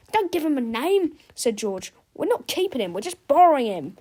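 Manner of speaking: put-on boy's voice. A narrator's reading. George correcting Harold.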